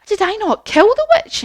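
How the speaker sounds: put-on high, puzzled girlish question; high-pitched bewilderment, rising in volume; Dorothy. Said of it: "And Dorothy said".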